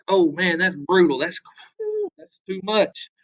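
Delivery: lightly voiced, pitch jumping on "whew"; a mock gasp of shock at the brutality; appalled Northerners. Teacher imitating shocked Northerners, mild mock dismay.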